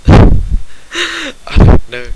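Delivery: giggling helplessly; laughing. Real, not acted. Reader cracking up over the silly fanfic, laughing. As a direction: breaks up laughing at the text.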